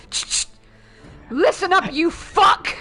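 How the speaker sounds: hammed-up mobster menace; mock thug threat. Threatening the director over the musical, mock menace. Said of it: "I'm just like".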